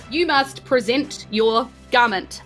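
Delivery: usual speaking voice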